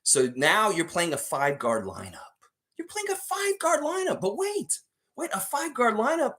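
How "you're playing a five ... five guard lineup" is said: mock disbelief.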